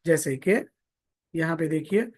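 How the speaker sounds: ordinary talk, nothing marked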